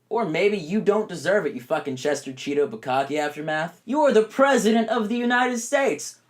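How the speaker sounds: usual speaking voice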